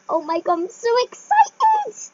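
excited squeal at normal volume. Real, not acted. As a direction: stretch it out and soar up with excitement.

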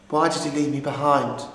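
ordinary talk

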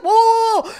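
high, loud repeated whoas; imitating husband bracing at the gate; alarmed. Husband alarmed as the car nears the gate. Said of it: "And Carter's like".

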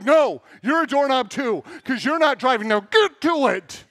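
loud, high scolding of another driver; hammed-up irritated order; mock road rage. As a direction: snap back in comic exasperation. Acted rant at the other drivers.

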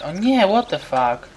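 slow, rising shocked cry; shocked outburst. Real, not acted. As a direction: caught off guard, loud. Sudden setback in game.

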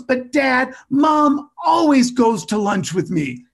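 put-on high, loud, slow kid whine; whining child. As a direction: whine like a little boy. Son whining.

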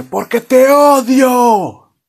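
drawn-out, high, loud outburst; mock furious scream. Kevin tells his father the truth, screaming angrily. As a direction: play a teen screaming hatred, all out.

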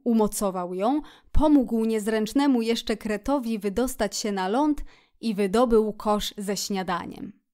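plain reading voice

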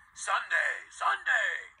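booming monster truck announcer. Acted. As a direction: hype it like a rally announcer. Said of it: "It's like the Monster Truck Rally announcer".

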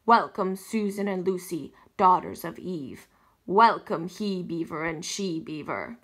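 low, loud and solemn lion king; a regal lion's deep welcome; deep, rich lion voice. A narrator's reading. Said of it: "His voice was deep and rich".

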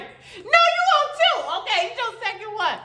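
talking through laughter; giggling through the words; laughing. Real, not acted. Laughing during the challenge.

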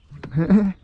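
short delighted chuckle; a burst of laughter at the catch; happy laugh. Real, not acted. Fisherman laughs with joy over his fish.

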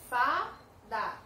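"fada" drawn out slowly as fa, da; split into syllables. Shown as a demonstration. Teacher helping children find the repeated syllable, "fada" split fa, da. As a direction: slowly separate "fada" after fa. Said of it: "Qual é a sílaba que se repete".

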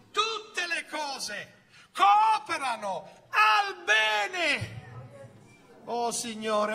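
normal talking voice

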